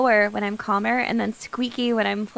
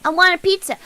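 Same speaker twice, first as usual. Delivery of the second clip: high mock Italian kid voice; whiny child begging in mock Italian; jolly italian pizza chef; 5 semitones higher, 6 dB louder than usual. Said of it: "walk around Italy like".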